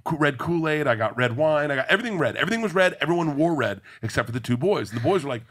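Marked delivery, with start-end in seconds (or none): none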